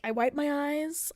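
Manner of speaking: ordinary talk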